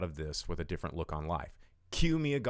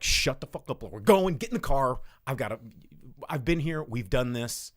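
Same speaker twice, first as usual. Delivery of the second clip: loud, high barked commands; bossy order; 4 semitones higher, 5 dB louder than usual. Retold or imitated. Mia Goth's character barking at the panicking couple, loud. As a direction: snap at them to get in the car.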